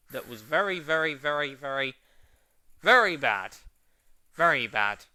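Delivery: each very pushed harder until a loud very bad; escalating exasperated stress on very bad; building emphasis. Real, not acted. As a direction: pile up the verys and slam very bad. Emphatic rant about bad episode.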